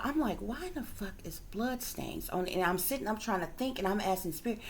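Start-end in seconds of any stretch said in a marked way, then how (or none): 0.4-2.5 softer, private thought voiced under the breath; inner thought, hushed. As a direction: say it quietly, as if only in your head. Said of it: "I didn't say it out loud".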